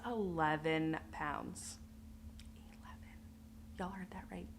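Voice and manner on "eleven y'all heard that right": whispered confiding aside. Real, not acted. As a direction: lean in and whisper it to the viewers. Aside to viewers about weight.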